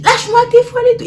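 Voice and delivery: high, loud and fast pleading; squealing boy. Retold or imitated. Boy being squeezed, squealing fast and high. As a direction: yelp it out fast.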